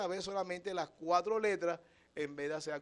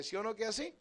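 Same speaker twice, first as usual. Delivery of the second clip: usual speaking voice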